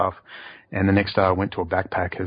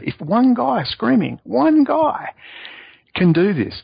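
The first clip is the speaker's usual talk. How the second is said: "one" punched much higher and slower; emphatic; 12 semitones higher, 3 dB louder, slower than usual. Real, not acted. Telling Max what one guy can do, emphatic. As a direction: hammer home the word "one". Bragging about his one-man campaign.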